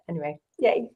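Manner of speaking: ordinary talk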